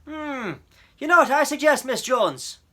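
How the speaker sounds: put-on loud officer humoring a child; big gruff voice, offering kindly advice; gruff policeman. A narrator's reading. Policeman offers Miss Jones a suggestion, loud and amused. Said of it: "he said".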